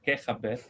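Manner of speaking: "qué jaber" with the j of "jaber" said as a Spanish jota; Ladino greeting. Shown as a demonstration. Teacher giving the Ladino how-are-you to class, jota in "jaber". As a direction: say "qué jaber", xaber with a throaty j.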